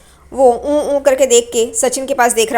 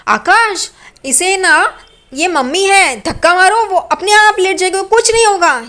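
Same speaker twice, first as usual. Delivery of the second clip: put-on slow, higher childish Riya voice, rising louder; Riya's voice; 8 semitones higher, 4 dB louder, slower than usual. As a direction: drawl out a naive girl's idea. Riya voiced, silly suggestion. (रिया की धीमी, ऊँची, बचकानी आवाज़)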